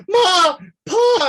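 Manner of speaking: high, loud wail for his parents; crying child's wail. Acted. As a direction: sob it out like a scared little boy. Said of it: "he starts crying".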